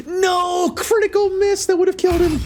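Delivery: real anguished outburst; frustrated cry. Real, not acted. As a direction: shout in dismay at the miss. Critical miss in game.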